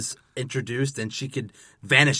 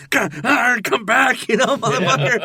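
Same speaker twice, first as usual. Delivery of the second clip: high, loud hammed-up wail through a laugh; mock Enchantress call; 11 semitones higher, 6 dB louder than usual. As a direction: wail for her to come back, mocking. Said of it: "it's like".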